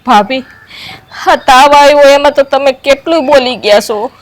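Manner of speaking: loud, hurt retort; loud protest. Acted. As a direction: shout back at the sister-in-law. Angry quarrel reply.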